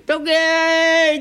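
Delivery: slow, very high, loud "どけーい"; clear-the-way shout. Acted. Boatman yelling at people to clear the way, loud and drawn out. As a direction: bellow it long to clear the way.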